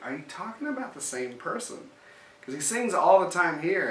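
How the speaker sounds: mock mother baffled at the report, voice climbing; incredulous mom. Mom quoted, disbelieving the teacher.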